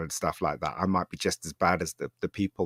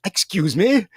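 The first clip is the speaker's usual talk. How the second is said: high, loud affronted "excuse me"; the snooty manager, affronted by the shop manager, in high loud disbelief; indignant surprise; 12 semitones higher, 8 dB louder, slower than usual. Retold or imitated. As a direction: sound scandalised that anyone talks back. Snooty man indignant at being challenged.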